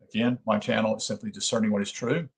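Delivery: ordinary delivery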